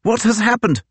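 high alarmed call; crying out. Acted. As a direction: shout it out, just woken and confused. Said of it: "he cried".

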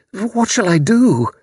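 slow, high wail of helplessness; moaning. A narrator's reading. Mother asks helplessly after kidnap, moaning slowly. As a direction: draw it out in a high, despairing moan. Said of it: "moaned Missus Sprott".

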